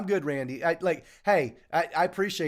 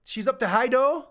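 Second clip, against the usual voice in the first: Scottish accent; 8 semitones higher, 5 dB louder than usual. Shown as a demonstration. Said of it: "I don't know if I'm doing that right".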